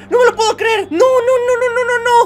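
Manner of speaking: high, loud cries of disbelief; excited shouting. Real, not acted. Streamer yells as Rodolfín falls off the crane, high shouts. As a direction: yell out as your buddy tumbles.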